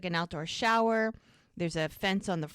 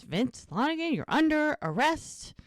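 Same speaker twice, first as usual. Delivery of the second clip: higher-pitched mock arrest announcement; official arrest; 7 semitones higher than usual. Podcaster retells the lawman arresting Vince, formally announcing.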